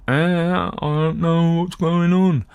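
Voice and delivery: high, very slow put-on dopey accent; confused Watson in a made-up accent; odd accent. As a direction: drawl it out like a baffled, slow-witted sidekick. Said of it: "I don't know what accent that was".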